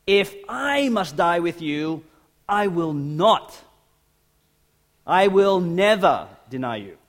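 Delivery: slow, high, emphatic vow. Acted. Peter swears he will never deny Jesus, emphatic. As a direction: insist on it, slowly and forcefully. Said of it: "But Peter said emphatically".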